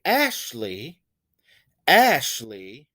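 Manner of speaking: Ashley with a strong, drawn-out ASH; stress on first syllable. Shown as a demonstration. Teacher showing the stressed syllable, Ashley stressed on ASH. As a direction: punch ASH, let the end of Ashley go light. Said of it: "I do stress the first syllable".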